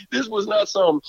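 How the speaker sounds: ordinary delivery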